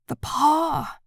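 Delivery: sudden high, loud cry out of sleep; a wife crying out wildly in the night. A narrator's reading. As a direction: cry it out wildly, waking in a rush. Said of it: "she cried wildly".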